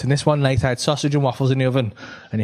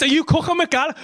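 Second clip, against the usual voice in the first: higher accusing wife voice; wife's question, voiced higher; mock wife; 11 semitones higher than usual. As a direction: play her suspicious question high.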